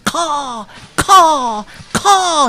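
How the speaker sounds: shrill bird alarm call; crow alarm caws. Acted. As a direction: caw out a warning to the whole forest. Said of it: "he shouted".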